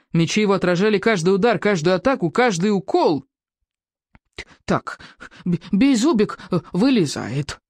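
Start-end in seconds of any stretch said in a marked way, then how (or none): 4.6-7.6 small dragon stammering, muffled under a helmet; muffled inside a helmet. A narrator's reading. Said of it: "приглушённо заявил Беззубик из-под шлема".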